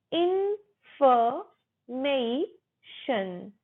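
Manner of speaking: 'इन्फॉर्मेशन' slowly as in, fuh, may, shun; syllable by syllable. Shown as a demonstration. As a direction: break 'इन्फॉर्मेशन' into four held parts, no R. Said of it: "र silent".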